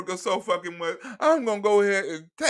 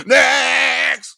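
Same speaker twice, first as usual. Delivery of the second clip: loud call; 4 semitones higher, 9 dB louder than usual. Real, not acted. Host calling next act.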